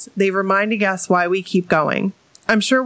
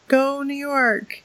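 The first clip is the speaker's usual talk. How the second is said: high, slow stretched cheer; slow drawn-out cheer; 6 semitones higher, much slower than usual. Pretend.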